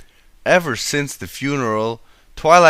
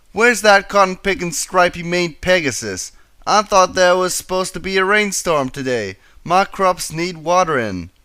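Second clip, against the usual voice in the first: higher, louder country farm pony grumble; a fed-up farm pony voiced higher and louder; frustrated Applejack; 6 semitones higher, 3 dB louder than usual. A narrator's reading. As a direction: gripe loudly about the missing rain. Applejack complains about missing rain.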